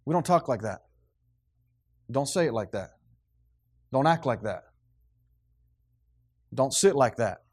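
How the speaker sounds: a father reining in his son's manners; stern father correcting. Retold or imitated. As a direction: stop the boy short like a firm dad. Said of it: "When they do that, say".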